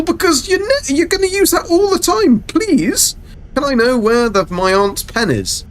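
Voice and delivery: high sarcastic sing-song; mock how useless the phrase is, high and arch; mock sarcasm. Streamer mocking school French lessons in a high sarcastic voice. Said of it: "mocking school French phrase".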